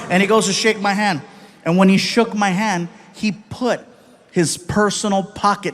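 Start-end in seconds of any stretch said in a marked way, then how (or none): none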